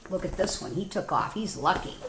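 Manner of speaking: ordinary delivery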